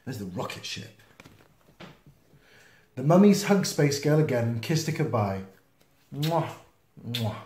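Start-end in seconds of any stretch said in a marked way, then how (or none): none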